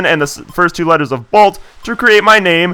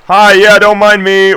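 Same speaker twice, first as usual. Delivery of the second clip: higher, louder character voice; 5 semitones higher, 5 dB louder, slower than usual. Acted. Voicing the character who found him.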